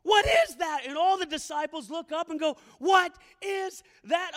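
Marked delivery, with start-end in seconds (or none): 0.0-0.8 high, loud startled shout; terrified cry. Acted. Rower in the storm sees a figure, terrified shout. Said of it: "Of course they're terrified". 2.8-4.3 slow, high, loud alarm; terrified cry. Acted. Disciples look up at the figure, terrified shout. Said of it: "Of course they're terrified".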